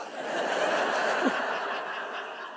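real silent wheezing laugh; a real airy laugh with no voice; breathless laugh. Real, not acted. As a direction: laugh breathlessly at your own story. Laughs after punchline.

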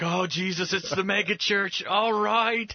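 high, rising mock enthusiasm; excited disciples. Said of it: "And they're like".

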